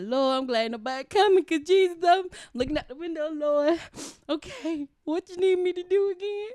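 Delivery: high, loud crying plea to the Lord; tearful prayer. Retold or imitated. Crying at work, pleading to the Lord high and loud. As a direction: pray through tears, voice lifted high. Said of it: "I started crying at work".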